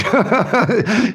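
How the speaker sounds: a short high laugh, rising. Real, not acted. Laughing at own dog boast.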